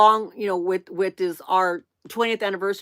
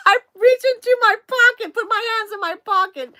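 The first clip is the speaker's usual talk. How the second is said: high, loud squeal of laughing speech; helpless laughter; 13 semitones higher, 6 dB louder than usual. Real, not acted. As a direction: barely get it out for laughing. Laughing hard at own story.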